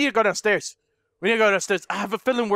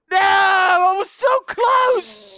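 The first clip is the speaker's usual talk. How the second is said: high, loud and slow, crying out; anguished cry; 7 semitones higher, 4 dB louder, much slower than usual. Real, not acted. Gamer caught near the goal, anguished cry. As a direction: wail at losing it so close.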